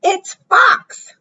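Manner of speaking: very high mouse voice; high-pitched announcement; chirpy little mouse. Acted. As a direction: pipe up high as a small storybook mouse.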